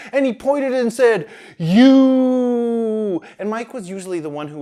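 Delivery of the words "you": loud, accusing "You!"; accusing shout. A narrator's reading. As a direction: call him out across the store. Manager points and accuses.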